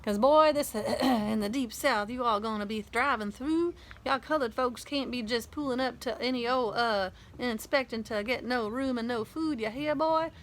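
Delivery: playful Southern hillbilly accent, mocking. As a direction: ham up a mock Southern hillbilly lecturing a boy. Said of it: "Dad did an imitation of a hillbilly accent".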